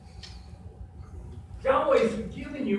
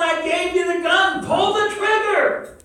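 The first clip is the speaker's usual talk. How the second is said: God's urging command; 8 semitones higher, 7 dB louder than usual. Acted. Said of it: "He's going".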